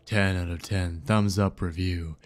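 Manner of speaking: low, solemn voice of the ocean; grave, deep-pitched sea entity; ominous ocean. Acted. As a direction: pronounce doom in a low, even register.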